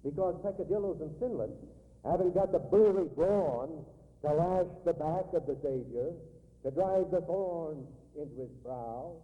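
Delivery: loud, rising sermon climax; preaching at full force. Acted. Preacher rousing the congregation about Christ's death, loud and forceful. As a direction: drive it home to the congregation.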